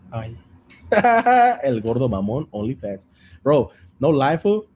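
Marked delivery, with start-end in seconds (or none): 0.8-1.6 high, loud burst of laughter. Real, not acted. After the whispered radio bit, laughing loudly. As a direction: explode into laughter.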